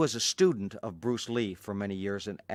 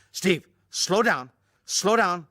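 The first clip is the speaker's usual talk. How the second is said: higher and louder, slow, urgent; frightened plea; 9 semitones higher, 8 dB louder, slower than usual. Retold or imitated. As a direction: beg him to slow down. Bruce begging in speeding car.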